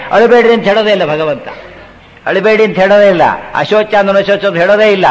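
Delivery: ordinary talk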